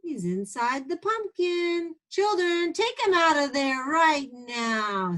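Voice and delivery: lightly voiced teacher calling the children; a touch of the scolding teacher; hint of a teacher. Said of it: "said the teacher".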